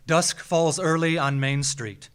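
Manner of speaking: plain reading voice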